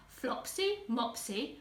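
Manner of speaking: plain reading voice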